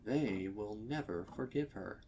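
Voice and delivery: ordinary delivery, nothing marked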